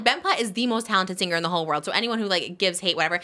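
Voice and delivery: usual speaking voice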